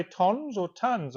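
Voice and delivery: tons first as "tonns", then as "tuns"; two pronunciations. Retold or imitated. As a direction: contrast tons with an O against tons like "tuns". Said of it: "it sounded different depending on how".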